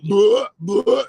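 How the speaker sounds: high, loud imitation of gagging; mock retching. Friends see the wound, mock retching. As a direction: pretend to heave in disgust.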